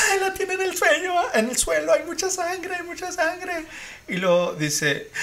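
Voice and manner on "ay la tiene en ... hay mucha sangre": high, sobbing panicked report; crying panic. Retold or imitated. Caller describing the attack to the operator, crying. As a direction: imitate a hysterical caller crying. Retold frantic 911 call, crying.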